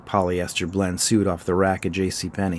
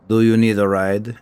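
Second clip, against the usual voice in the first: gruff Eastern European cab driver asking; thick European accent; 4 dB louder than usual. A narrator's reading. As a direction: taxi driver to passenger, thick Russian-like accent. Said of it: "in a thick European accent".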